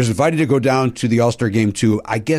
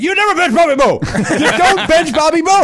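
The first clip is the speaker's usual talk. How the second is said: much higher, hammed-up scolding; mock-indignant outburst; 14 semitones higher, 4 dB louder than usual. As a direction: imitate an outraged star, laughter creeping in. Acted indignant ballplayer scolding him.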